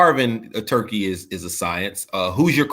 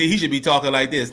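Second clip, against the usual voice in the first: usual speaking voice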